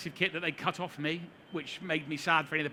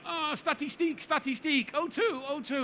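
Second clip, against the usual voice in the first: much higher, hammed-up French accent on static steak and oh two; bad comic French, pitched way up in panic; mock French accent; 8 semitones higher, slower than usual. Strapped to a board in the helicopter, he pleads in mock French accent. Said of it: "in my best French".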